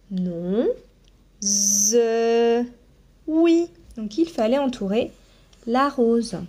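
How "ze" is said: "Ze" with a long buzzing z; drawn-out syllable. Shown as a demonstration. Teacher sounding out rose, drawn-out buzzed "Ze". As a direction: stretch "Ze", buzzing into the vowel. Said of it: "Alors, on y va".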